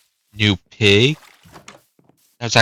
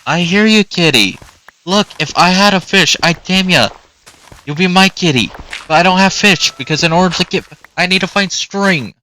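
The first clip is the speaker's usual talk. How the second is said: higher, sing-song pet voice; talking to a kitty; 7 semitones higher than usual. Acted. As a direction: coo at the cat like a pet owner.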